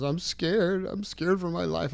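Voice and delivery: high-pitched imitation of a scared daughter; retold frightened crying. Daughter crying to dad on the drive, retold frightened plea. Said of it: "she's crying, screaming".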